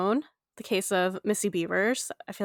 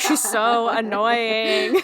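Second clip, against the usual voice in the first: annoyed whine; 2 semitones higher, 5 dB louder, much slower than usual. Retold or imitated. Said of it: "Usually I'm the one that's like".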